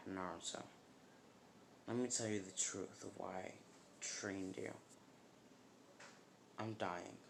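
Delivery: put-on soft, solemn old master; hushed, halting admission; grave confession. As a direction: break the hard truth quietly. Dying master confesses the truth.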